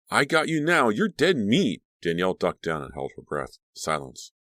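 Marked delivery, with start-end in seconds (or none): 0.1-1.8 menacing taunt. Acted. Threatening voice in hiding scene.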